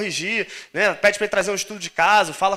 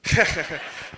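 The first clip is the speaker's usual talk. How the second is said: amused laugh; 3 semitones lower, 4 dB quieter than usual. Real, not acted. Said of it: "Eu sou raposa ruiva?".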